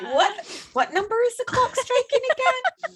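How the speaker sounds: high-pitched question cracking up with laughter; incredulous self-mockery. Host mocking her own typo, incredulous and laughing. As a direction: laugh through a baffled retelling of your own reaction.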